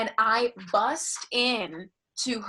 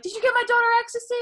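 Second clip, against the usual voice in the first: fast, much higher, louder mom accusation; frantic mom; 14 semitones higher, 8 dB louder, much faster than usual. Retold or imitated. Mother demanding about ecstasy, fast and shrill. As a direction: rattle it off, shrieking high. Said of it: "she was like".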